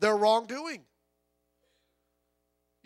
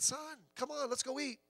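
put-on softer, gentle old farmer inviting the boy in; kindly old farmer; 4 semitones higher, 11 dB quieter than usual. Preacher voicing the old farmer calming the boy, softly. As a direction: soothe him like a kindly old neighbor.

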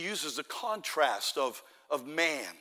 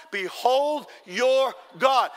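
half-speed pulpit chant-along, higher and louder; congregational lead-in; 6 semitones higher, 7 dB louder, much slower than usual. Shown as a demonstration. Preacher has everybody repeat the three words, slow loud proclamation. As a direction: spread the words out so all can join. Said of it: "Say it one more time".

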